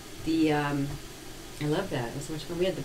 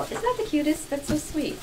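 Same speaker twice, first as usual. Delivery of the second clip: high, delighted cooing; high gushing; 10 semitones higher than usual. Real, not acted. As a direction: coo over a cute gift. Gushing over fan gift.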